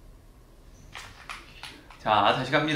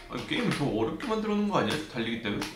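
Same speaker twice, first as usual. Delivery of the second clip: usual speaking voice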